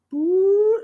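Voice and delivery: high siren wail. Acted. Siren-like sound.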